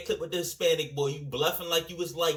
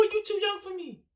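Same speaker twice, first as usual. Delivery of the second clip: mock quote. Reacting to a clip, mocking a line.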